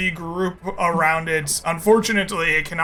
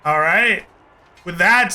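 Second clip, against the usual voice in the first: ordinary talk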